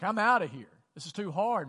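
a hammed-up parent bailing on the fight; mock storming off. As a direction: toss it off like a parent walking out. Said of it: "They'd just be like".

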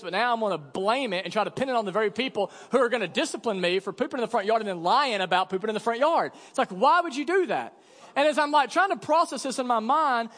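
ordinary talk

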